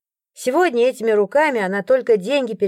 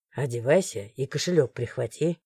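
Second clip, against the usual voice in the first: low, soft, confiding male voice; quiet guard; 8 semitones lower, 6 dB quieter than usual. A narrator's reading. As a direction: lean in and say it quietly. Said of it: "тихо произнёс Морган". (тихо, низким голосом)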